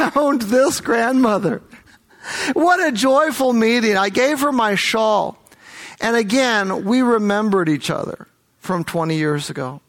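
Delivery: normal talking voice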